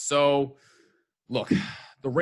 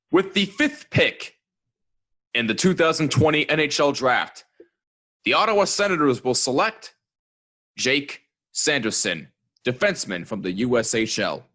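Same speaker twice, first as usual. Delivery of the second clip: draft announcer; 3 semitones higher, 6 dB louder than usual. Acted. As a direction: announce the pick like the commissioner. Mock draft pick announcement.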